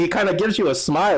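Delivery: ordinary talk, nothing marked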